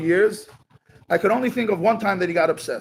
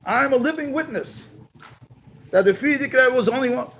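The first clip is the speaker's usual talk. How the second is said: raised, higher-pitched indignant retort; upset protest; 5 semitones higher than usual. Retold or imitated. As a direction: insist you're a living witness, a bit heated. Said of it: "Reb Reuven got very, very upset".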